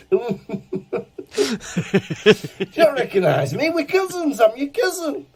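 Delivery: high mock old lady, pushing her claim; old woman voice. Actor retelling the fan who claimed to be his cousin, high voice. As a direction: squeak it out like the eager old cousin.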